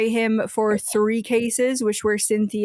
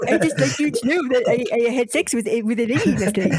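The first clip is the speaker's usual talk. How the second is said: Jimmy impression; faster than usual. Host doing a South Park character for friends, stuttering boy voice. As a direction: stammer it out like a cartoon kid. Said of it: "South Park Jimmy".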